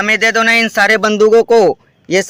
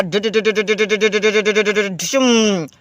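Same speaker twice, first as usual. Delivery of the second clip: gun noises. Pretend. Firing toy gun, big finish.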